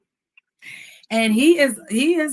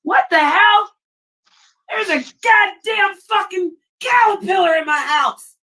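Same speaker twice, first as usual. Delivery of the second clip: startled scream, then high-pitched alarmed swearing; high, panicked outburst; real freak-out; 9 semitones higher than usual. Real, not acted. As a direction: shriek and curse at a bug you just found. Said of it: "I'm freaking out".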